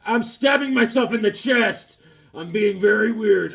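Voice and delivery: high, loud cartoon exclamation; mock dramatic cry. Drawn guy stabbing himself, mock dramatic cry. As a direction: announce it in a hammed-up high voice.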